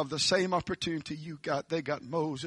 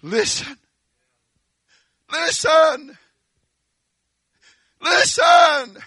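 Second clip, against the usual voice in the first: from a lifted call up to a much higher, louder shout; building call; 10 semitones higher, 12 dB louder, slower than usual. Acted. Preacher crying listen to the church, building to a shout. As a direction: build each listen harder.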